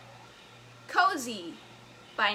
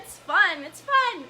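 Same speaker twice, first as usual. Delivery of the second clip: rising, bright repeat of it's fun; excited; 5 semitones higher, 7 dB louder, slower than usual. Real, not acted. Host delighting in the dance with viewers, excited. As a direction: squeal it with glee.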